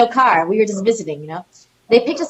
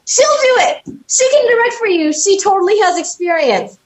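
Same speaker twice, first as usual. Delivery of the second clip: panicked husband; 12 semitones higher, 3 dB louder than usual. Retold or imitated. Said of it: "he literally was like".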